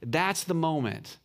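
ordinary talk

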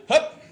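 sharp, high, loud hup like a hike call. Retold or imitated. Imitating his dad warning him, sharp high loud hup. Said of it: "and I went".

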